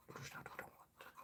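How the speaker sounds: hushed whisper aside. Acted. Vlogger whispering to young viewers, hushed whisper. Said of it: "Do not comment by my channel".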